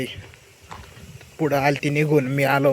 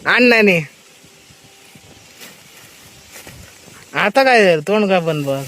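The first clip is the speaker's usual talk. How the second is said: loud teasing; 5 semitones higher, 7 dB louder than usual. Real, not acted. Teasing shout across field.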